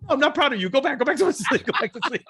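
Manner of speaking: high laughing mock retraction; mock pleading. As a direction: beg her back to sleep, laughing. Retracting praise to calm daughter.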